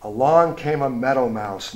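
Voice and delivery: usual speaking voice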